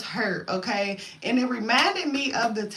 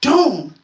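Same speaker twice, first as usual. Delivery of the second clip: loud, booming "doom"; imitated door slam; 3 semitones higher, 10 dB louder than usual. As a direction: hit "doom" hard like a slamming door. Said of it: "I slammed the door".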